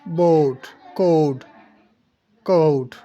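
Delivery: बोट, कोड, कोट with aa and o run together; aa-o glide. Shown as a demonstration. Teacher demonstrating to students, blended aa-o in बोट, कोड, कोट. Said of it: "आ और ओ को बहुत short करके".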